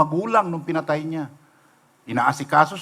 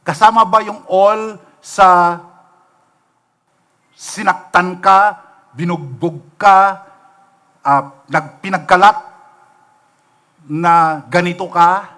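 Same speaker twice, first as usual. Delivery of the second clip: forceful slow challenge; 6 dB louder, slower than usual. Acted. Pressing the congregation on enemies.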